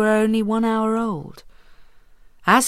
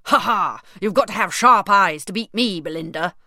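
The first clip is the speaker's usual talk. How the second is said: louder, gloating king voice; triumphant king; 6 dB louder than usual. Acted. King gloating to Belinda after winning Snap, loud and smug. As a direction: crow over the beaten child. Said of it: "cried Stormbelly triumphantly".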